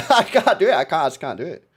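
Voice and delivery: loud give-up through laughter; laughing. Real, not acted. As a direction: crack up mid-attempt at your own failure. Breaks up after failed accent.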